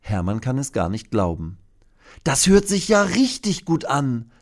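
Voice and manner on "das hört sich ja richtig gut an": hohe Dinostimme, begeistert; erfreuter Dino. Acted. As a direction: freu dich hörbar mit. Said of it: "denkt er". (hohe, erfreute Dinostimme)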